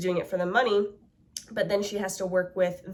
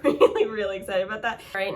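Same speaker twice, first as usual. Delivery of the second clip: usual speaking voice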